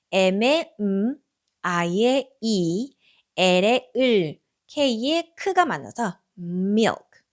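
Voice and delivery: milk built from drawn-out letter sounds; slow letter sounds. Shown as a demonstration. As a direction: stretch each sound, then join into milk. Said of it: "milk라고 발음을 해주시면 되고". (글자 소리를 아주 천천히 늘여서)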